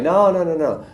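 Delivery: high quick no-no-no; breezy refusal. Retold or imitated. Stubborn man refusing the second truck, high airy no's. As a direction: wave it all away lightly.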